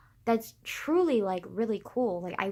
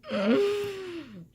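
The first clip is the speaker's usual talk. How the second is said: odd sighing noise; 9 semitones higher than usual. Real, not acted. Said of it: "what was that noise".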